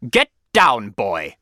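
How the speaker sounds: loud headmaster's command; stern and loud, falling on the last word; barked order. A narrator's reading. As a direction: order the boy down forcefully. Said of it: "Mister Coombs ordered".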